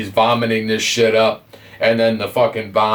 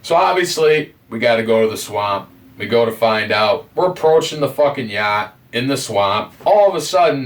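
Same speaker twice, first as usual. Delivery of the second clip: normal talking voice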